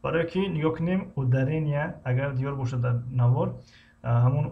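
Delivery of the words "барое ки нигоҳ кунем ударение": ordinary talk, nothing marked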